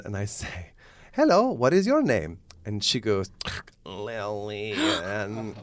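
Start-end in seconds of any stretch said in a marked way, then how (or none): 1.1-2.4 high, louder Italian clown voice; hammed-up comic Italian accent; stupid Italian accent. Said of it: "in this stupid Italian accent". 3.8-5.5 imitated mechanical voice-box monotone; buzzy electrolarynx imitation; speaking-box voice. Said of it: "she had, like, a v-, speaking box".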